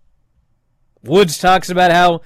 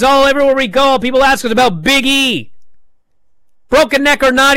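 retold angry yell; 9 semitones higher, 5 dB louder than usual. Woods keeps accusing Big E.

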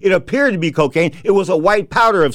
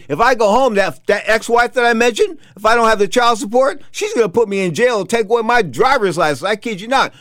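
worked-up protest; 7 semitones higher than usual. Retold or imitated. Retold protest about unpaid wages.

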